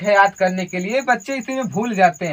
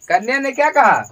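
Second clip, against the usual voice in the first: ordinary delivery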